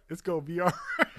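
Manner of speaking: fast and loud, laughing through the words; mock the reply while cracking up; laughing quote. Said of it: "And you be like".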